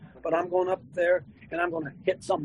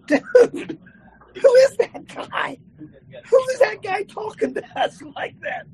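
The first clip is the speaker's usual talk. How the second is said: high, slow, laughing and incredulous; retelling David with a cracking laugh; laughing disbelief; 14 semitones higher, 7 dB louder, slower than usual. Retold or imitated. Preacher retelling David, laughing disbelief.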